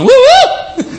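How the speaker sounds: high, loud "woo, woo"; two big falsetto-like hoots; snake-scaring whoop. Acted. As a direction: whoop high to scare something off. Noise to scare off snakes.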